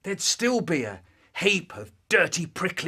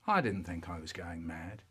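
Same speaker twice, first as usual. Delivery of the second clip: usual speaking voice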